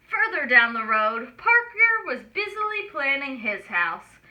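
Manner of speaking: ordinary delivery, nothing marked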